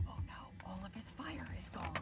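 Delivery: soft dramatic whisper. Acted. Reader whispering to the children about the dragon. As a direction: share a secret with the kids.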